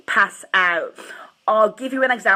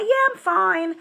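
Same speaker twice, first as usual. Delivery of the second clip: high-pitched quick reassurance; insisting she's fine; 10 semitones higher than usual. Retold or imitated. Daughter answering her mum's check, high insistent reassurance. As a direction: brush off the worry in a higher voice.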